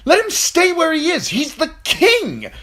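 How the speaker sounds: high, loud furious shout, slowing on the king; shouting knight defying the queen; angry shout. A narrator's reading. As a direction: hammer it home at full volume. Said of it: "as he shouted".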